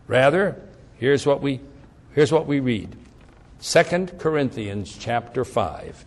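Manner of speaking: ordinary talk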